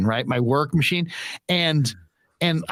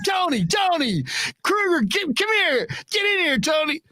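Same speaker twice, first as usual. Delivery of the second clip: mock yell summoning someone; imitated yelling down the hall; 13 semitones higher than usual. Colleague summoning another to hear the story, high mock holler. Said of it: "he yells down".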